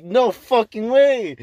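high, loud and slow stretched-out shock; stunned exclamation; shocked disbelief. Retold or imitated. Shocked at news.

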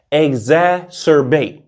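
exacerbate spoken slowly, syllable by syllable. Shown as a demonstration. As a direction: draw out exacerbate slowly for clarity. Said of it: "let me say that one time slow".

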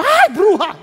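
husband shrieks at his barefaced wife; startled cry. Retold or imitated. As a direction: jump back in mock fright. Husband sees wife without makeup.

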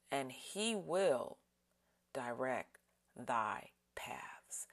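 very slow, long pauses, fading to a whisper at the ends; drawn-out prayerful reading ending in a hush; slowest reading. Shown as a demonstration. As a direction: dwell on each word, then let it fall away breathy. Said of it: "allow the Holy Spirit to emphasize certain words".

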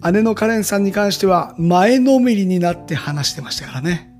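upbeat teaser narration; punchy variety show voiceover. Acted. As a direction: sell the next episode. (バラエティ番組風のナレーション)

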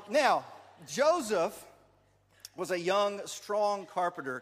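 loud, emphatic storyteller; story narration. Acted. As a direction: introduce the hero with big stretched words. Narrates nativity play for children.

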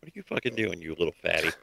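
low muttered scolding. Acted. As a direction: grumble at the pest. GM scolding the cat.

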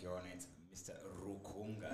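Rukunga with hard R and K, quietly firm; mild firm correction, crisp consonants on Rukunga; correcting the judge. Retold or imitated. Lawyer correcting the judge on his name, quietly firm. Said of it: "I hit my R's and my K's, like, really hard".

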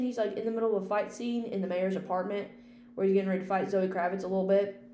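ordinary delivery

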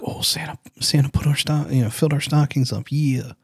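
high-pitched imitation of thrilled children; excited kid. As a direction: squeal it lightly, like kids spotting presents. Retelling kids' thrill at full stockings.

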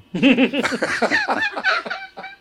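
squealing high laugh. Real, not acted. Comedian laughing at his own paisa joke, high squeal. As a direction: let a high laugh rise. Reaction to paisa accent mention.

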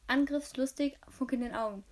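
ordinary delivery, nothing marked

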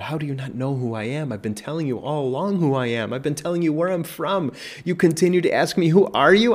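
rising exasperated pleading, loud at the end; frustrated. Acted. Preacher imagining Jesus frustrated with the crowd, rising exasperation. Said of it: "how is Jesus not getting frustrated".

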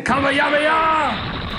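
high, slow shouted Kamehameha; anime battle cry. Retold or imitated. Brawler yelling at cops with an anime battle cry. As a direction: scream "Kamehameha!" like an anime attack. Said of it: "He kept saying".

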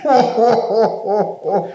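mock laughing friends. Imitating friends laughing at him.

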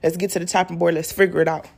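low-pitched man ready to help; baby daddy's voice. Acted. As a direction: drop your pitch, play the dependable baby daddy. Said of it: "He be like".

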